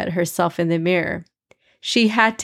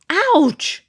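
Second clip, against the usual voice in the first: high yelp of pain; sharp ouch; 11 semitones higher, 3 dB louder than usual. Acted. Amina yelping at the pin poke, high sharp ouch. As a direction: yelp like a pin just poked you. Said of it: "Amina exclaimed".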